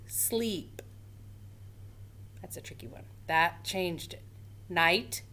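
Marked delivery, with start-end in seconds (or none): 2.4-3.0 whispered, as if sharing a secret; whispered aside. Real, not acted. Teacher whispering to pupils that the word is tricky. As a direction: lean in and whisper it.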